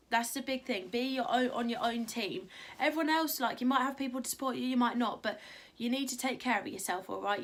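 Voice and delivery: usual speaking voice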